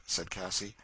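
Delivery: usual speaking voice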